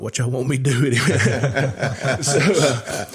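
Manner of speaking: laughing through the question. Real, not acted. Laughs while retelling the call.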